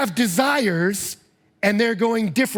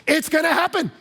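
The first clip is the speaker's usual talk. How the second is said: emphatic warning; 8 semitones higher than usual. Retold or imitated. Pastor warning couples, mock insistence.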